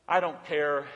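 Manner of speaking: ordinary delivery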